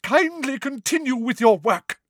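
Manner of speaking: high, overwrought professor; high-pitched theatrical huff; indignant order. A narrator's reading. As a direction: order the class sharply in a high voice. Furious teacher orders class back.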